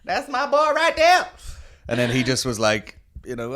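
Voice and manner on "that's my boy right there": hammed-up yell from the audience; cheering from the crowd. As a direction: belt it out like a proud fan. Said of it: "That was, that was just me".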